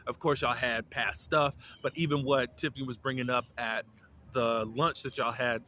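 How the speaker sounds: ordinary talk, nothing marked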